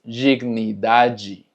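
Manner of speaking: dignidade slowly as "jignidaji"; DI y DE como ji. Shown as a demonstration. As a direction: spell out dignidade with "ji" at both ends. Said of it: "termina en D E". (dignidade pausado, dicho «jignidaji»)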